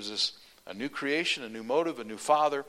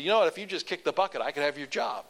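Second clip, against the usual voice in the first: quick, loud teasing dig; high, fast playful taunt; teasing jab; 5 semitones higher, 5 dB louder than usual. Retold or imitated. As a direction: needle your old friend with a joke. Teasing friend about dying.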